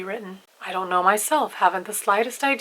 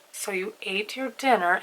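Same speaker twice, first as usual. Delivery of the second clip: ordinary delivery, nothing marked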